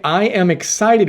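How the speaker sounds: lifted, bright excited line; upbeat excited. Shown as a demonstration. Coach showing the better phrase, lifted and energized. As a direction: say it like you mean the thrill. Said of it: "It's much better to say".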